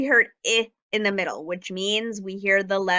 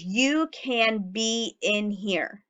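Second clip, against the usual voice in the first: slow read sentence; much slower than usual. Shown as a demonstration. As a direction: read it slowly and carefully. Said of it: "My sentence today says".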